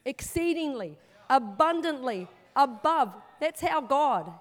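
loud building proclamation; preaching at full force. Real, not acted. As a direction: ring it out to the congregation, rising in force. Preacher proclaims scripture phrase.

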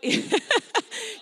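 a high giggle breaking through; high laugh. Real, not acted. Preacher cracking up while mocking a grudging spouse, high giggle. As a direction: cracks up mid-impression.

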